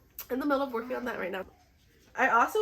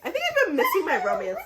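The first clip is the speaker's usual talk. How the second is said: laughing it out, higher and louder; 11 semitones higher, 8 dB louder, faster than usual. Real, not acted. To viewers, laughing about missing romance. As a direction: giggle through the confession.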